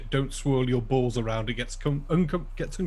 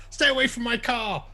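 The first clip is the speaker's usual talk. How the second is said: mock shout; 9 semitones higher than usual.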